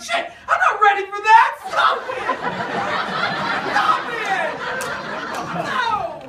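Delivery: giddy reader shouting at spicy chapters; mock flustered yelling. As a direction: yell at the book, giddy and scandalized. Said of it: "You're like".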